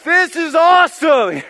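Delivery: high, drawn-out, very loud yell; re-enacted over-loud shout of delight; excited yelling. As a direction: holler it like an overexcited tourist in headphones.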